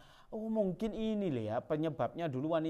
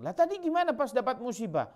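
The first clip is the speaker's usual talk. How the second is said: skeptical challenge; 9 semitones higher than usual. Pretend. Questioning the late claim.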